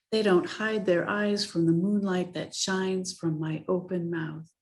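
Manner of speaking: put-on gentle, even reading to children; story narration. A narrator's reading. Reads picture book aloud.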